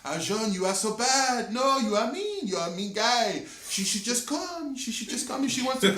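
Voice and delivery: high, sing-song mock French accent. French girl pleading for her friend to come, high mock French accent. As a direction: scold in an exaggerated French accent, pitched high. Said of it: "this is a French accent".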